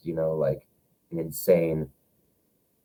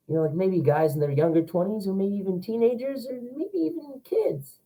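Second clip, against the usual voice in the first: put-on high young voice; 13 semitones higher than usual. Shown as a demonstration. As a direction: pitch shooting way up, kid-like. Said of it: "because I can go up in there".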